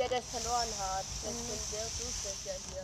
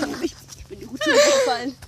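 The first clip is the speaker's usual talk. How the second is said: surprised; 11 semitones higher, 5 dB louder than usual. Real, not acted. Falling down the slide.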